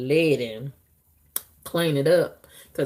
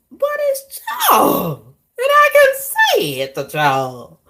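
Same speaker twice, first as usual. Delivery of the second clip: mock whining cry; 6 dB louder than usual. Telling listeners she can't say it to Charles, hammed-up wail. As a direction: wail it like a crybaby. Whining about talking nasty again.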